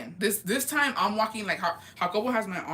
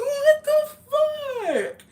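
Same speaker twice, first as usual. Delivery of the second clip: much higher and louder, near-crying shout; shocked scream; 6 dB louder, slower than usual. Retold or imitated. Thrown into the truck's back seat, a high crying shriek. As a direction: shriek it in disbelief.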